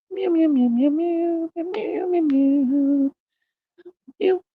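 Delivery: very high, drawn-out kitty meows, slowing at the end; string of cat meows. Pretend. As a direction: mew like a contented little cat, over and over. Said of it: "It's such a happy kitty".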